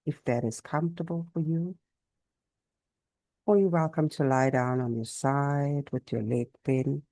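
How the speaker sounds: calm yoga nidra guidance; guided meditation. Real, not acted. Teacher settling a class into Yoga Nidra, calm guided meditation. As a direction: settle them in gently, pausing between phrases.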